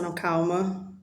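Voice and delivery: soft calming inner voice; calming yourself. Retold or imitated. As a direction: talk yourself down gently. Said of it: "aí você vai pensar".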